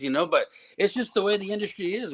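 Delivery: ordinary talk, nothing marked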